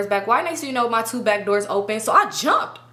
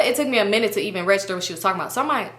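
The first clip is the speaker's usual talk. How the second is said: ordinary talk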